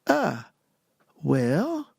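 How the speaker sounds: very slow, drawn-out, halting reply; hesitant agreement. Retold or imitated. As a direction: stretch each word, unsure what you are agreeing to. Hesitant answer to surprise job offer.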